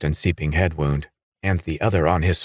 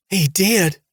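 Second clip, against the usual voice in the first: eager, much higher pitched backing-up; vigorous; 13 semitones higher, 3 dB louder than usual. A narrator's reading. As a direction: jump in eagerly to defend him. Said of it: "Noreen put in vigorously".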